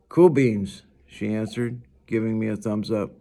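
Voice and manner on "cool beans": louder, upbeat cool beans; cheery. A narrator's reading. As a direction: toss it off with a thumbs up. Summer's cheerful sign-off.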